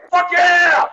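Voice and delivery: quick question, then a high yelled cheer; brisk setup bursting into a jubilant scream; instant screamed answer. Shown as a demonstration. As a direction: fire back an explosive high shout right on cue. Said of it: "It was, ... Right away".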